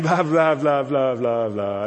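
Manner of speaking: loud droning blah-blah, sinking at the end; mock your own meaningless chatter, starting loud; Charlie Brown's teacher. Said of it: "sounds like that to myself".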